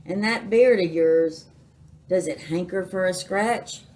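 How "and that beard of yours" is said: usual speaking voice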